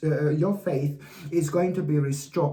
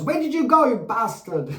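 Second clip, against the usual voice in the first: high, louder mock scolding; mock-angry shout; 5 semitones higher, 3 dB louder than usual. Scolding her vanished voice as a joke, high mock shout. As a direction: playfully yell at your own lost voice.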